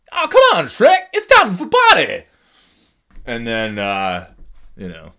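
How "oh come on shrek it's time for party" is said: very high, loud silly Donkey squeal; squeaky party-crasher; goofy Donkey voice. Retold or imitated. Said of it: "They're just like".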